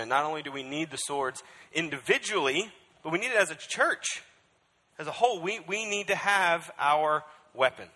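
rally the whole church; full-force preaching. Acted.

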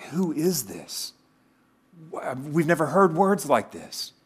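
amazed disciples marvelling; hushed-to-rising astonishment; acted awe. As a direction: wonder at what you have just heard. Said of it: "we know they're in awe".

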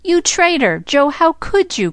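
high-pitched, scandalized outcry; shocked, high reproach; indignant cry. A narrator's reading. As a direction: cry out at a friend's betrayal. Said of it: "cried the three girls".

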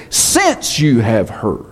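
slow, with weight on "Since"; emphatic translation. Shown as a demonstration. Pastor to congregation, giving the better translation slowly and emphatically. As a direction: hold "Since you have heard" slowly, stress on since. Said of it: "it's an emphatic statement".

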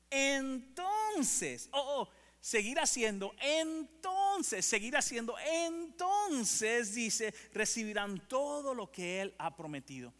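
high, loud repeated cry; impassioned preaching. Acted. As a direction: hammer the word home with fire. Preaching climax on Hebrews.

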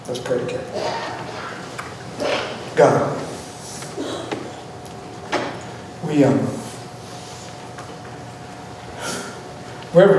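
soft, low prayer voice; closing prayer. Acted. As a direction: pray gently over the congregation.

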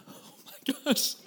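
high, slow, breathy whine of despair; mock despairing whine. Dad hearing his son throwing up, high mock whine. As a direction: squeak it out, hammed-up dismay. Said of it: "I'm like".

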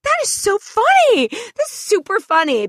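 high, slow, dripping sarcasm; sarcastic praise. Retold or imitated. Answering the bully's joke with slow sarcasm. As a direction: drag out the fake praise.

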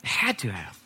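low whisper; softly breathed, weighty comment; hushed aside. Real, not acted. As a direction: let it drop to a whisper. Hushed aside on Peter's pain.

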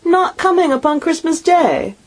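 can't believe she's missing Christmas; crestfallen father. A narrator's reading. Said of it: "sudden declension in his high spirits".

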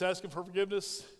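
ordinary talk, nothing marked

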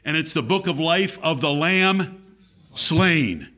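weight on "Lamb", then "slain" set apart and punched; stress on LAMB, SLAIN. Real, not acted. Preacher points listeners to the book of life, stressing "Lamb" and "slain". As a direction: stress "Lamb", pause, land hard on "slain". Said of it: "with the emphasis on the word lamb and the emphasis on the word slain".

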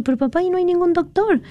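retold high-pitched objection to dad; high childlike protest. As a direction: squeak it like a puzzled daughter. Daughter protests to father.

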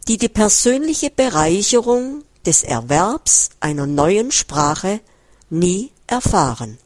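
ordinary delivery

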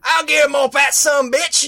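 put-on cranky old man shouting high and loud; old prospector. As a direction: yell it like a crusty old codger. Repeated as yelled line.

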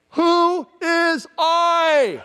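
high, loud, slow holler; exaggerated long-distance call; mock shout. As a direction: yell it back across the water. Mock shout back to Jesus.

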